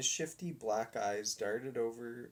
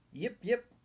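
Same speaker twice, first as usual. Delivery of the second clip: much higher excited little barks; dog barking eagerly at the showman; eager yips; 10 semitones higher than usual. A narrator's reading. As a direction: yip like a happy pup. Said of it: "barked the dog eagerly".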